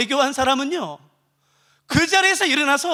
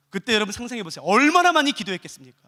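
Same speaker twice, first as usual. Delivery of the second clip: usual speaking voice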